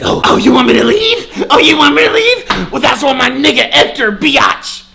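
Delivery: high, loud imitation of an angry girl; mimicked yelled taunt. Girl taunting him after being asked to leave, mock yelling.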